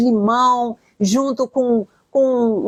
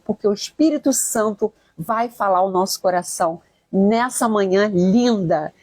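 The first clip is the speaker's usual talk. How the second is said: normal talking voice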